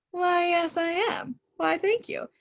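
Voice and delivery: high, mock-genteel acceptance; playful delighted acceptance. Acting her delighted acceptance.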